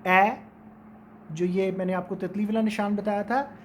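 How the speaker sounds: ordinary delivery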